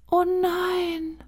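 a tiny horse gasping in dismay. A narrator's reading. Unseen horse speaks in dismay.

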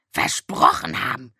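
versprochen haben croaked low and voiceless, rising; raven croak. A narrator's reading. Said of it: "krächzte der Rabe Abraxas". (krächzender Rabe, spöttisch)

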